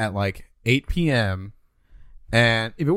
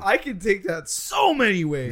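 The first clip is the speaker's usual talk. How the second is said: laughing; 7 semitones higher, 3 dB louder than usual. Real, not acted. Laughing at the double meaning.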